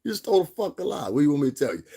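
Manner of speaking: high, loud mock exasperation; exasperated retold call-out. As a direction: push it up high, re-enacting the accusation. Replays calling Chris a liar.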